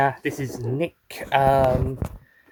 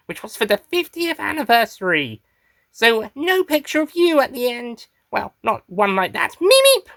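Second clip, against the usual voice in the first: raised-pitch creature voice; Meep character voice; 5 semitones higher than usual. Acted. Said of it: "getting the voice into, uh, into character".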